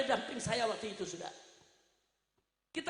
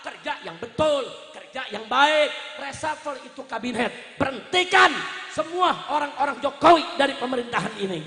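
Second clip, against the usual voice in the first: loud, hammering political oratory; impassioned shouted demands to a leader; forceful admonishment; 10 dB louder than usual. Real, not acted. Admonishing the President before crowd.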